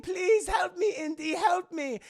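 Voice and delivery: high-pitched mock damsel cry; mock plea. Elsa at gunpoint begs Indy, high mock plea. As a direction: plead in a put-on high voice. Hostage Elsa begging, acted.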